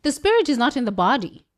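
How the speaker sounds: quick, crisp British-accented delivery. Shown as a demonstration. To her viewers, repeating the line in her British accent. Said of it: "Let me go back to my British accent".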